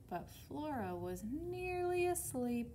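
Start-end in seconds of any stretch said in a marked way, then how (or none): 1.2-2.7 nearly asleep, stretched out and higher; drowsy narration. A narrator's reading. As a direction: draw the words out as if drifting off. Narrator acts out Flora dozing off.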